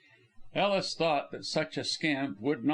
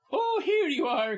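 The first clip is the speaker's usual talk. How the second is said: high, jovial greeting; jovial cry; 4 dB louder than usual. Acted. Actress Polly greeting the visitor, jovial and high. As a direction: sing out a hearty welcome. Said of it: "cried Polly, jovially".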